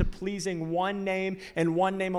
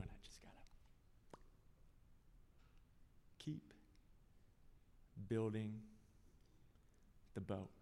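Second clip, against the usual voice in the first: soft, hushed and lower; quietly resolved, barely voiced; determined whisper; 7 semitones lower, 20 dB quieter than usual. Noah quietly insisting he'll keep building.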